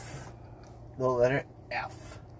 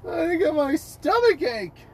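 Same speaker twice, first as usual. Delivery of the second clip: mock whining; 3 dB louder than usual. Fake moaning complaint.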